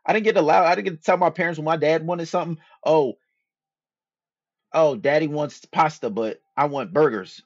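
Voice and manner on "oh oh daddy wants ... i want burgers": a hint of a whiny child, a bit higher and louder; mock child. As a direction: lightly imitate a kid complaining. Child voicing complaint about dad.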